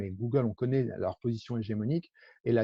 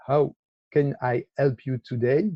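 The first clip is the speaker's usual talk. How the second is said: slow, heavily French-accented English; bad accent in English; 3 semitones higher, 4 dB louder, slower than usual. Shown as a demonstration. Repeating the chat's default greeting after apologising, bad French accent. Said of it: "J'ai un mauvais accent".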